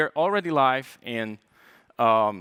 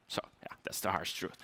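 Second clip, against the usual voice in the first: a hushed, confessional whisper; whispered aside; 6 semitones lower, 12 dB quieter than usual. Real, not acted. Speaker confides his job's truth to the audience, whispered.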